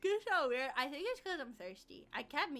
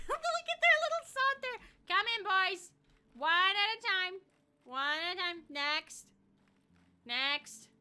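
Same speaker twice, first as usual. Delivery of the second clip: lightly voiced teacher, higher and louder; teacher herding kids; 5 semitones higher, 5 dB louder than usual. Streamer ushering the robots into the room, hint of teacher. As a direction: call them in one at a time, mock bossy.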